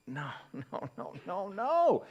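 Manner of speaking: rising, hammed-up refusal; mock upset. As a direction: build into exasperated denial. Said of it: "I'm getting upset".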